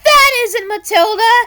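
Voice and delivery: high, loud horrified shout; horrified cry. Acted. Birds horrified at the collapsing gates, high loud cry. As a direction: cry out in horror. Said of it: "horrified cries".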